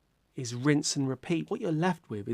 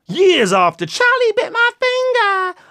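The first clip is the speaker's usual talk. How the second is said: much higher, slow, loud mock wail; 10 dB louder than usual. Vlogger admitting he found YouTube late, mock wail. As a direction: wail it in comic anguish.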